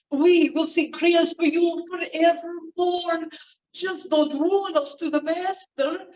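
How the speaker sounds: high, slow pleading; begging manservant. Acted. Nicholas begging the old woman, high and pleading. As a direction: beg for mercy.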